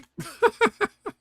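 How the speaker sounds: sudden high giggle burst; high burst of laughter. Real, not acted. Killed Lord British by mistake.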